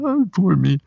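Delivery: high mock whine; whiny man child. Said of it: "you can whine every now and again".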